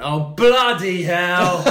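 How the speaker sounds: drawn-out, high, loud mimicked child's outburst; defiant shout. Naughty kid defying parents before the family, imitated defiant shout. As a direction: bellow it slowly, stretching the swear word.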